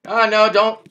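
loud high cry of alarm; high, loud panicked no; panicked shout. Real, not acted. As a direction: yell at them to stop. Panic as another player interferes.

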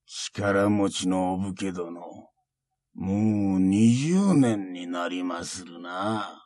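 put-on very slow, soft old monk; drawn-out quiet taunt; creaky wise old man.

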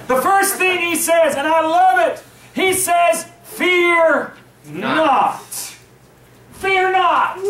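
fired-up sermon delivery; preaching. Real, not acted. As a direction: drive the point home to the church. Preaching builds.

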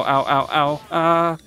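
normal talking voice

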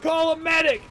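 man down shout. Pretend. Calling out in game.

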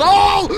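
sudden loud, high yelp of fright; real shocked cry; startled scream. Real, not acted. Gamer jumps at a fright in the game, startled scream. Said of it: "Ik schrok me oprecht dood".

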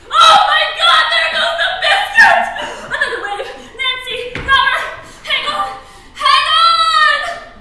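very loud, high cries; panicked screaming. Pretend. Woman acting a wave hitting, calling to Nancy, frantic. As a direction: scream warnings as the wave hits. Wave strikes boat in the game.

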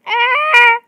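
sudden high loud scream; startled scream. Real, not acted. Gamer startled by a fly, short scream. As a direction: shriek in fright.

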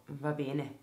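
quiet, resigned okay. Retold or imitated. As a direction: give in, soft and defeated. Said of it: "con un tono rassegnato". (rassegnata, a voce bassa)

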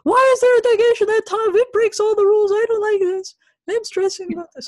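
very high-pitched panicked whine; squeaky exaggerated distress, easing in volume; mock panic. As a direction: read it as a frantic, hammed-up plea. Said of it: "I wrote a panic message".

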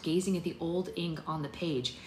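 usual speaking voice

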